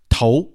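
头, "tou", for Vietnamese 寿; Vietnamese reading of 寿. Shown as a demonstration. Host teaches how Vietnamese reads 寿, 头 pronounced "tou". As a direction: say 头 as the Vietnamese sound of 寿. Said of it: "在越南语里这个寿的发音是".

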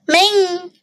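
put-on high, drawn-out call to a child; mom calling out. A narrator's reading. Mom yells to daughter that supper is ready, calling out. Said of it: "yelled Mom".

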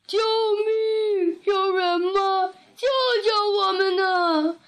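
high, drawn-out howling wail; hammed-up wailing animals; wailing for help. A narrator's reading. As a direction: cry out long and high for rescue. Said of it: "三个动物嚎啕大哭起来".

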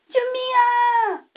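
high, loud cry for help; shouting hard. A narrator's reading. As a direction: yell for help with all your might. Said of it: "使劲儿喊着". (使劲高声呼救)

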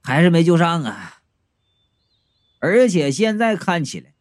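ordinary delivery, nothing marked

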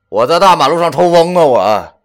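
irritated retort, loud and high. A narrator's reading. Friend asks where he is, irritated retort. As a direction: snap it back in annoyance. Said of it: "便没好气儿地说".

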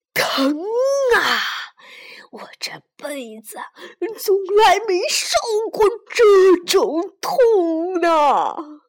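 loud, slow howl of pain; pained roar. Acted. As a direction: roar as if burning. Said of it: "他吼着".

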